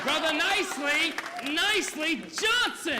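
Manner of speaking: loud, slow call of his name. Acted. Gambler calling his friend up to testify, slow loud call. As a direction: summon him up to the front.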